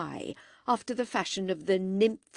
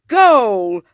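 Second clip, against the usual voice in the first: louder cry; 3 semitones higher, 15 dB louder than usual. A narrator's reading. Boys playing football with clothes.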